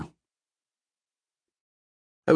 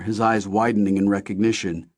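ordinary delivery

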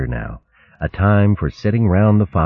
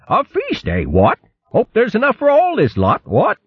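high, dithering, hemming and hawing; fussy old hare hinting he is hungry; stammering old hare. Acted. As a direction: bluster politely while angling for food. Hare eager for feast.

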